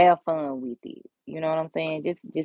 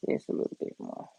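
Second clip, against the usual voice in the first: soft whisper under her breath; whispering to herself; 10 dB quieter, faster than usual. Real, not acted. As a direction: murmur it to yourself while concentrating. Whispering to herself while working.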